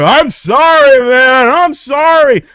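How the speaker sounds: high, loud, frantic pleading sorry; scared pleading apology to an angry stranger; panicked apology. Retold or imitated. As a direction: beg him to calm down, voice up high. Apologising to freaking-out guy.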